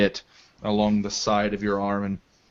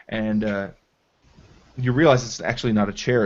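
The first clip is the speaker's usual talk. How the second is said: ordinary delivery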